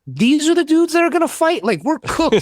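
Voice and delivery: high, stunned disbelief at the soldiers; incredulous disbelief. Retold or imitated. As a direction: throw it up high in shock. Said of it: "I was just like".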